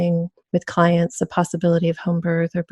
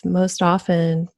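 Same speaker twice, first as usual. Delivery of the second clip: ordinary talk, nothing marked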